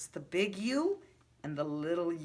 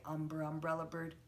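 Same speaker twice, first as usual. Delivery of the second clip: put-on quick, softer "Umber, umbrella bird, uh" for the children to copy; a quieter model line; soft demo; 2 semitones lower, 8 dB quieter, faster than usual. Shown as a demonstration. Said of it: "So you say".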